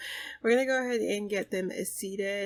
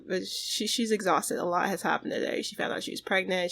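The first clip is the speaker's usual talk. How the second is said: ordinary delivery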